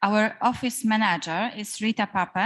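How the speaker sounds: ordinary delivery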